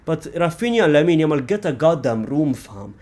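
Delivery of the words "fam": usual speaking voice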